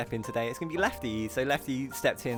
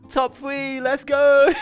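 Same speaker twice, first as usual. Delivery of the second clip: excited cheer; 12 semitones higher, 12 dB louder, much slower than usual. Real, not acted. Cheering a top three finish.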